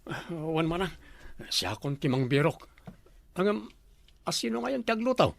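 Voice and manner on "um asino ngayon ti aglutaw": normal talking voice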